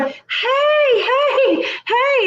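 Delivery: high, laughing hey hey; mock calling out. Neighbor calling from porch, high laughing call. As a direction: hail her across the lawn. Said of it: "and she's going".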